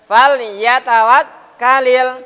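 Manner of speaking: Fal yatawakkalil recited slowly, lam said clearly; clear idhar lam. Shown as a demonstration. Teacher demonstrating idhar recitation. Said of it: "lam fi'il, hukum bacaannya idhar".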